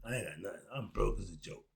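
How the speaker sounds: quiet, low mock poverty plea; softly faked I'm-broke act; mock pleading broke. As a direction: play broke, hushed and pitiful. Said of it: "I'll act just like".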